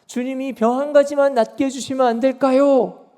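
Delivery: high, insistent imitated plea; pleading. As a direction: act out a sick man begging the Lord. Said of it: "하면서 간청하는 이 사람을".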